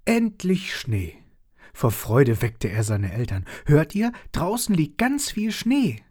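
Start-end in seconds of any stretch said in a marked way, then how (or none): 0.0-1.1 put-on high, loud and slow delighted child; overjoyed cheer. 3.6-6.0 much higher, louder eager announcement; thrilled child rousing parents; excited child. Acted. Child wakes parents with joy.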